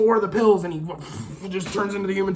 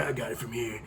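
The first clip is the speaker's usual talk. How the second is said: much lower and softer, under the breath; low-key imitation; 8 semitones lower, 13 dB quieter than usual. Cop dismissing the guard, imitated low and soft. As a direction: say it quietly like you're sneaking off.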